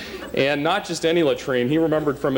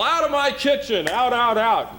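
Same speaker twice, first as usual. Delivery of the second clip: high-pitched mock yell of a cook shooing kids; retold battle cry; 11 semitones higher than usual. Scoutmaster chasing kids from his camp kitchen, high mock yell. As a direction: shout them out like gnats, pitched way up. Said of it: "he'd yell".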